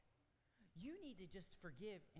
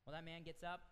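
ordinary talk, nothing marked

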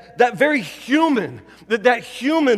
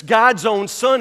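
ordinary delivery

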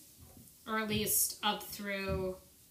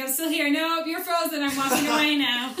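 loud, higher acted-out bickering; playful mock argument; 5 semitones higher, 8 dB louder than usual. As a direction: play both sides of a silly quarrel, loudly. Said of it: "And then be like".

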